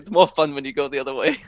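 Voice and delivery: lightly put-on Scottish lilt for the quip; Scottish accent. Fan retelling the Doctor's quip in a light Scottish accent. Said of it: "in a Scottish accent".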